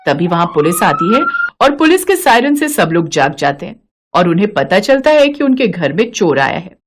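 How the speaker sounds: ordinary delivery, nothing marked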